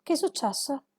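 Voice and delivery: normal talking voice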